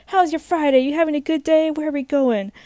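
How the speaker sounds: loud, high, eager questions; hyped driver. Retold or imitated. Driver greeting his tired rider, eager and loud. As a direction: pepper the rider with bubbly small talk. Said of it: "Like, all hyped".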